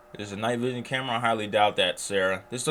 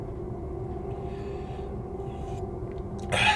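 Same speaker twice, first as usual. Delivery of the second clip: high breathy sigh; 3 dB quieter than usual. Real, not acted. Reacting to freaky photos.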